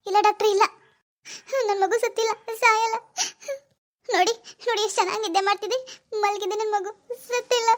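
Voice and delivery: high, crying protest; tearful denial. Acted. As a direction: plead through tears, refusing to believe it. Mother refuses news of stillbirth.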